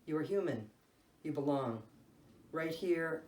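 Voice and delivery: ordinary delivery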